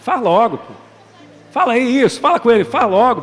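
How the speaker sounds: loud, high mock nudge to a neighbour; bossy friend shoving you to act; playful loud urging. Congregant telling a neighbour loudly to just do it. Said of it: "vira pra ela e fala".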